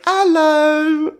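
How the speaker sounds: very high, squeaky cartoon greeting; high-pitched cartoon piglet hello; Peppa Pig. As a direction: pop up with a drawn-out squeal. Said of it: "like Peppa the Pig".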